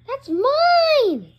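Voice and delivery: high, loud drawn-out cry; possessive yell. Acted. Squabbling over Legos, high possessive yell.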